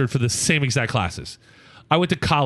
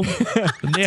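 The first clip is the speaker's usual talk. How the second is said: higher, American-accented attempt at Spanish; mom's bad Spanish; 6 semitones higher than usual. Mom repeating in language lab, American-accented Spanish. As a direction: lightly imitate mom stumbling in Spanish. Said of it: "I can hear my mom".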